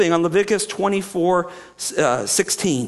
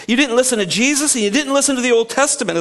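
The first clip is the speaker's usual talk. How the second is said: quick, higher-pitched imitated reproach; mock accusation; 6 semitones higher, faster than usual. Preacher voices the apostles rebuking the rulers, quick accusation. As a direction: voice their rebuke, fast and raised.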